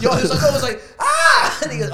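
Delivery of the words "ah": high, loud yelp of shock; imitated shriek. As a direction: act out her startled scream. Imitating the woman's reaction.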